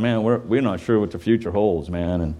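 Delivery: lightly voiced nervous elders; worried fellas. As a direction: voice the anxious old men fretting. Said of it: "these fellas are going".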